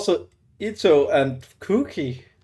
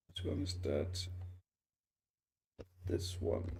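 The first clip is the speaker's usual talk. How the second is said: whispered aside; 11 semitones lower, 17 dB quieter than usual. Real, not acted. Quiet aside while checking game menu.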